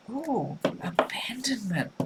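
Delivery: slow, breathy whisper of surprise; hushed whisper. Real, not acted. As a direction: whisper the card name slowly as if awed. Reading the card drawn.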